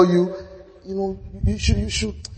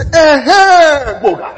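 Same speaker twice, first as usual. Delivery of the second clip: high, loud cry of a push; crying shout; 9 semitones higher, 8 dB louder, much slower than usual. Acted. Preacher acting out pushing a doubter, crying out high and loud. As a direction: shout it out like shoving someone forward.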